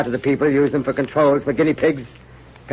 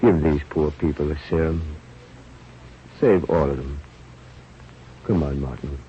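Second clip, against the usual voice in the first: feverish delirium; 10 semitones lower, 4 dB quieter than usual. Acted. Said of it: "Sandelius became delirious".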